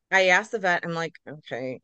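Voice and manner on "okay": low, very soft and hesitant; sheepish. Retold or imitated. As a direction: ease into it timidly, under your breath. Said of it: "kinda sheepishly".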